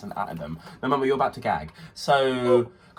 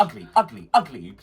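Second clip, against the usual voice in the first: imitated dance teacher; 3 semitones higher, 4 dB louder than usual. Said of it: "she'd go".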